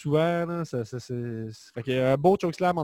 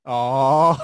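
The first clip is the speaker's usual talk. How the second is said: surpris; 7 dB louder than usual. Real, not acted. Traité de quarantenaire, il réagit.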